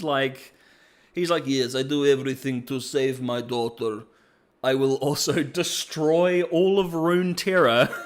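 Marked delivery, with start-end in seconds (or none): 1.5-7.9 hammed-up Russian villain; mock-grand vow in a Russian accent. Singed vowing to save his daughter, hammed-up Russian accent. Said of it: "I don't know why I made him Russian".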